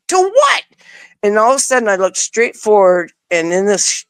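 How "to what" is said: very high, baffled retort. Retold or imitated. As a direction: yelp out the confusion. Confused after being hit again.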